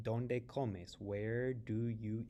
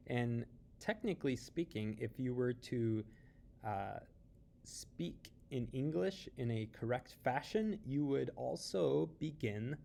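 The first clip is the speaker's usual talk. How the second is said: normal talking voice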